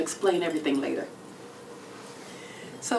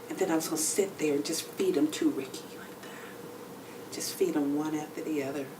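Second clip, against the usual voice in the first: whispered, teasing and intimate; seductive whisper; 5 semitones lower, 6 dB quieter than usual. Retold or imitated. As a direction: breathe it like a secret to your lover. Describing seductive chocolate feeding plan.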